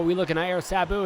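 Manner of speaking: ordinary delivery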